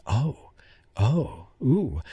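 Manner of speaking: soft, hushed oohs of wonder; awed reaction. Retold or imitated. Emily Blunt hearing the alien speak, hushed awe. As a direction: breathe out amazed little ohs. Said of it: "then she's like".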